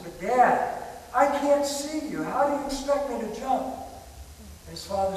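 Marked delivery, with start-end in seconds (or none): 0.0-3.7 high, pleading little boy, acted; terrified child crying. Terrified boy on the roof to his father, a high crying plea. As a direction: plead like a scared child, pitched up. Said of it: "he's crying, he says".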